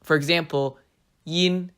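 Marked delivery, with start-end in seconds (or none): 1.2-1.7 Mandarin first tone "yin". Shown as a demonstration. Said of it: "utilizes these tones a lot more".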